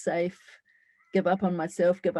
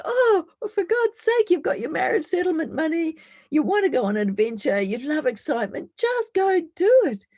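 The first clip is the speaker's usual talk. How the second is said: much higher, exasperated push; inner voice; 10 semitones higher, 5 dB louder than usual. Acted. Her inner voice pushing her to go on the adventure, much higher and exasperated. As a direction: urge her on, fed up with excuses. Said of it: "this little voice said".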